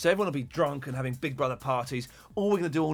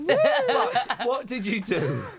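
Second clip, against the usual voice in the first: laughing; 7 semitones higher, 4 dB louder, much slower than usual. Real, not acted. Host laughing at caller.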